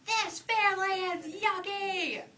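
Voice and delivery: high, loud, slow tantrum scream. Acted. Angry little bunny screaming at her family, tantrum. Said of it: "But Betty Bunny screamed".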